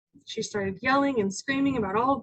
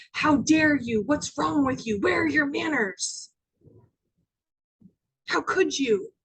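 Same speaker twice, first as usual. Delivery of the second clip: mock indignant parental telling-off; scolding parent; 3 semitones higher, 4 dB louder than usual. Parents imagined scolding their teen, indignant telling-off.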